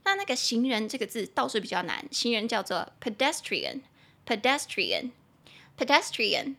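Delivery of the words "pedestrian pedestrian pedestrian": pedestrian, slow, with the stress on "de"; stress on second syllable. Shown as a demonstration. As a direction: say pedestrian slowly, pe-DES-trian. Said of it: "重音节在第二音节". (pedestrian 重音放在第二音节，慢慢念)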